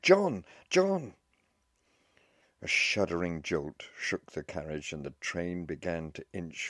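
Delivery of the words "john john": high, loud cry of his name; involuntary shout. A narrator's reading. Young wife calling for her missing husband, shouted. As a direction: call out desperately for someone far off. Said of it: "Involuntarily she shouted".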